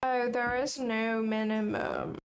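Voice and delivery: ordinary talk, nothing marked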